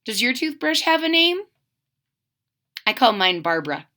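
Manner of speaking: ordinary delivery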